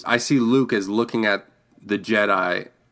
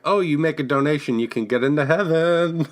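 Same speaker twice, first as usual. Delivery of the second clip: higher, lightly mocking church pitch; mock preacher; 6 semitones higher than usual. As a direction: parody a priest selling salvation.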